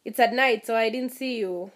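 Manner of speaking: hammed-up gushing sorry, tapering off; mock fake apology. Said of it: "I was like".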